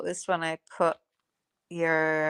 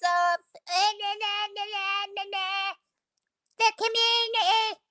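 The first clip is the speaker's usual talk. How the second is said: high, sing-song mocking imitation of her; high taunting nah-nah mimicry; mock whiny woman; 12 semitones higher than usual. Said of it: "And she".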